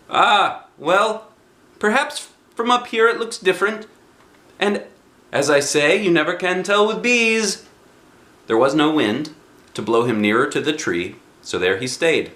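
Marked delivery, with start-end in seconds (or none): 0.1-7.6 high, bright bear voice calling out; Pooh's cheerful cry. Acted. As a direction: call like a happy little bear. Pooh voiced.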